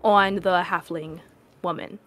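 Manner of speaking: ordinary delivery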